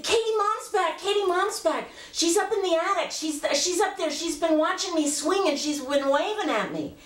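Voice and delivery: put-on very high little-girl voice, thrilled; excited child. Child telling her mother who is back, excited. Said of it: "I was so excited".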